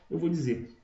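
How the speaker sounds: ordinary talk, nothing marked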